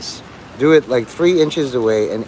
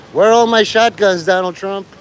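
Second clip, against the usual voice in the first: higher, louder jeering challenge; mock taunting yell; 6 semitones higher, 4 dB louder than usual. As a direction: bark it like a defiant dare.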